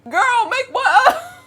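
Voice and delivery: loud, very high, slow disbelief; incredulous shriek. Acted. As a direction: screech it in mock outrage. Mocking her own past plea.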